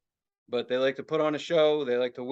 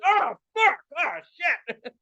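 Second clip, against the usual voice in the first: dad in agony; 9 semitones higher than usual. Retold or imitated. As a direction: cry out like a gassed dad. Imitates dad hit by fumes.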